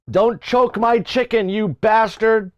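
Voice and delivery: mock neighbor. Mocking the neighbor.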